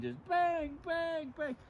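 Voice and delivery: high imitated bang bang; pan banging noise. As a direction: mimic clanging a pan. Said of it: "smacking the pan".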